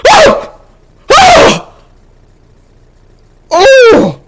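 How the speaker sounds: loud, very high startled "oh" yelps; incoherent shocked yelps. Retold or imitated. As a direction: yelp in shock, one burst at a time. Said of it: "I made an incoherent sound".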